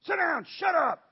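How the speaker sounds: mock-furious parent yelling; angry parent yelling. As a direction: bark orders at the kids. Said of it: "but this is what I do".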